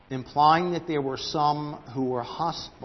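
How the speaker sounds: normal talking voice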